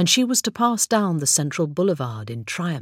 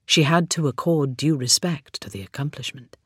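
usual speaking voice

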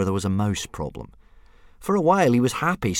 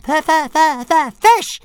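much higher, louder squealing dragon; demanding little dragon; 12 dB louder, slower than usual. Acted. Baby dragon Toothless squealing demands for fish. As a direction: screech for fish like a greedy hatchling. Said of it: "said Toothless again".

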